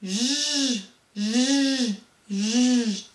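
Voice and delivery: long buzzing "zh", three times; drawn-out hum of the French J sound; water-jet sound. Shown as a demonstration. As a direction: imitate a water jet with a sustained "jjj". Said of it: "Quel est le bruit du jet d'eau?".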